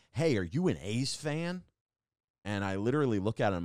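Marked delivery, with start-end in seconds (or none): 0.1-1.6 bright, loud friendly greeting, lightly imitated; mock eager stranger calling out; imitating cheerful guy. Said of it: "he just goes".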